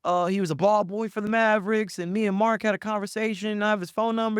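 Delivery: high, loud shady name-dropper; sketchy hustler. Retold or imitated. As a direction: play a sleazy fake insider. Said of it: "It wasn't like".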